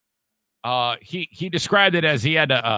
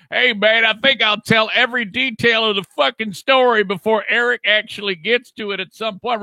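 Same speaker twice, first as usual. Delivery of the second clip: a lightly voiced impression of Kenny, raised pitch; imitating Kenny, teasing him about spoiling the story; mock Kenny voice; 6 semitones higher than usual. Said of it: "bust out the Kenny".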